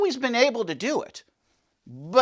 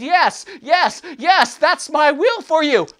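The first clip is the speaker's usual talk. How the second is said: voicing God; 6 semitones higher, 5 dB louder, slower than usual. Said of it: "that God said".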